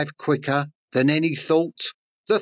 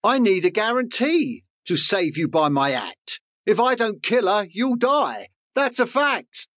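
rising higher into a bird's bargaining plea; nervous bird; 7 semitones higher than usual. Acted.

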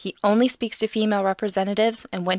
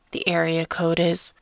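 creepy caller. A narrator's reading. Caller starts giving his number.

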